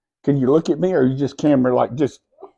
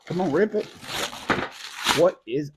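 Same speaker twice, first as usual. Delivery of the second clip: ordinary talk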